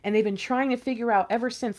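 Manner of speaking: ordinary talk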